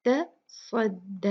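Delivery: तस्वद with a thick, heavy s; heavy swad. Shown as a demonstration. Teacher modelling a heavy swad, slow. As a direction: keep ता light, make the स्व of तस्वद heavy. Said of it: "स्वाद जो है यहाँ पर heavy है".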